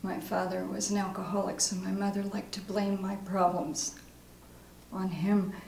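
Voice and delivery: subdued, soft confession at a meeting; recovering alcoholic. Acted. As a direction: share it quietly as a recovering alcoholic. Acting exercise, recovery meeting confession.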